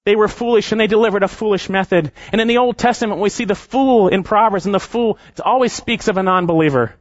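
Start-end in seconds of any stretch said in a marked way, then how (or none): none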